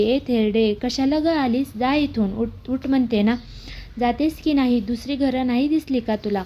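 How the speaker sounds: ordinary delivery, nothing marked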